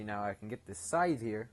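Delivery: ordinary talk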